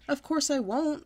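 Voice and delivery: sassy, defiant retort; talking back. Acted. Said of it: "I talked back".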